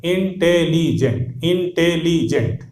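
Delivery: "इंटेलिजेंट" slowly split into in-te-lee-jent; wrong four-syllable intelligent. Shown as a demonstration. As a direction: count out four slow beats in "इंटेलिजेंट". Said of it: "चार syllable हो जाएगा". ("इंटेलिजेंट" धीरे-धीरे चार हिस्सों में, इन-टे-ली-जेंट)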